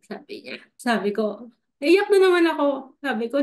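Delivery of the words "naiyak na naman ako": excited. Real, not acted. Recalling the sign moment.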